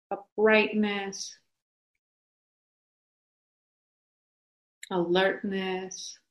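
slow, soft meditation voice; guided meditation. Acted. Teacher guiding a group into upright, settled posture, slow guided meditation. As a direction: settle them gently, with long pauses.

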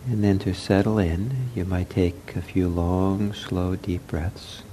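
soft, unhurried meditation voice with long pauses; guided meditation. Real, not acted. Leads a breathing meditation.

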